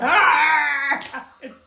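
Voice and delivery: a real burst of laughter, half a wail, loud and high; loud crying laugh. Real, not acted. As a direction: laugh out loud as if close to tears. Laughing wildly while retelling labor.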